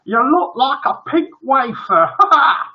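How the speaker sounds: high-pitched mocking jeer ending in laughter; taunt, then burst out laughing; heckling joker. Joker heckles the dressed-up family.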